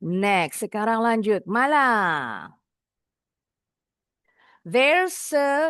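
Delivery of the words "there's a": "There's a" run together as one word; linked words. Shown as a demonstration. Teacher shows how to connect words, "There's a" linked. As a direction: join the S of "There's" onto "a".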